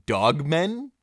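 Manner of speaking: ordinary talk, nothing marked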